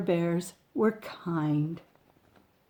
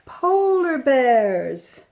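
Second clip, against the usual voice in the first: slow, loud, drawn-out reveal; big storybook reveal; dramatic reveal; 5 semitones higher, 6 dB louder, much slower than usual. Acted. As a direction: unveil it slowly and grandly.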